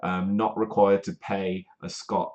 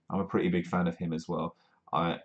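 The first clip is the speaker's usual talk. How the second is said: normal talking voice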